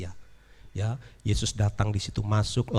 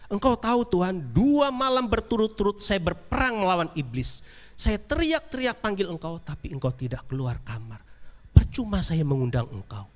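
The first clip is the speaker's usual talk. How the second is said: high, hurt reproach; raised, wounded complaint; reproachful rich man; 7 semitones higher than usual. Acted. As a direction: scold Jesus for abandoning you.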